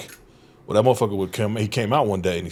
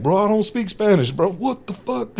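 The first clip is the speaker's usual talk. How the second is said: much higher, exasperated protest; retold exasperation; 12 semitones higher, 3 dB louder than usual. Caught not speaking Spanish, higher exasperated mock protest. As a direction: throw up your hands in disbelief. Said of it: "And I was like".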